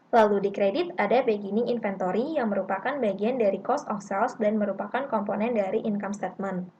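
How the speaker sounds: normal talking voice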